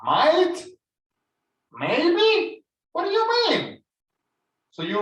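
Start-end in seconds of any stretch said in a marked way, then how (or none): 0.0-3.8 rising higher, incredulous; as if the promise just fell through; surprised protest. Retold or imitated. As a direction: homeowner questioning a vague promise, surprised. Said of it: "you're like, you were expecting me".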